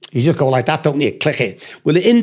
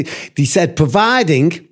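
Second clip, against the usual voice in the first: normal talking voice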